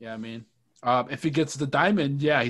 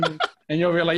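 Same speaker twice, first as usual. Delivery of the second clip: louder words through laughter; laughing; 3 semitones higher, 7 dB louder than usual. Real, not acted. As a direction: keep laughing as you talk. Cracking up over Little Mac.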